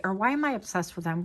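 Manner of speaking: ordinary delivery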